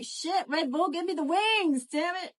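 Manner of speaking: hammed-up tired plea; mock pleading. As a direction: beg the can for wings. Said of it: "Theo's over here like".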